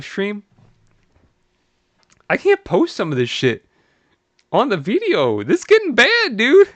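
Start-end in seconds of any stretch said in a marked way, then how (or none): 5.4-6.7 much higher, loud alarm; alarmed outburst. Real, not acted. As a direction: sound the alarm, half laughing. Alarmed reading messages.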